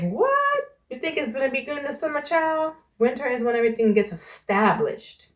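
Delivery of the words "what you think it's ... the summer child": high squeal of disbelief on What; mock disbelief. Mocking a doubter who dismissed summer. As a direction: scoff like a doubter proved wrong. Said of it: "you're gonna be like".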